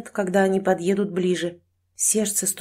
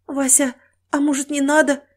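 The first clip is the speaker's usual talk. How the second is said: high, anxious pleading with husband; frightened wife's hesitant plea; horrified; 7 semitones higher than usual. Acted. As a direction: beg him timidly not to. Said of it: "в ужасе сказала жена".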